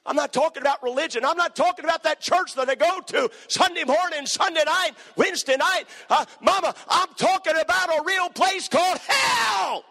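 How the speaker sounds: high, loud shouted plea; preaching at full force. Real, not acted. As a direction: cry it out to the rafters.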